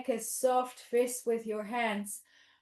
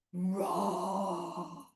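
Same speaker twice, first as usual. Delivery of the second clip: low, drawn-out roar; long play-bear rumble; big bear roar; 4 semitones lower, 5 dB quieter than usual. Pretend. Teacher praises the big bear, long roar. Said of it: "Big Bear".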